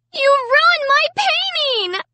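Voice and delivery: very high, drawn-out shriek; scream. A narrator's reading. Sister accuses brother of ruining painting, screaming. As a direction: wail it angrily at the top of the voice. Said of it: "Sarah screamed".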